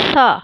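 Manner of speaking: "سا" with tongue tip at the upper front teeth; breathy lisped th opening "سا", open long vowel; demonstrated letter sound. Shown as a demonstration. Teacher showing students where the tongue goes, careful demonstrated "سا". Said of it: "آپ نے زبان لگانی ہے اور پھر لفظ یہ ادا کرنا ہے".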